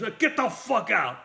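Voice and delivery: high angry yell; Burt Reynolds dismissing. Retold or imitated. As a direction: bark her out of the room. Star dismisses the nurse.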